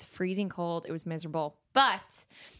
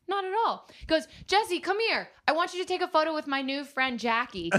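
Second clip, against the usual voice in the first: calling out; 8 semitones higher than usual. Retold or imitated. Woody calling Jesse over.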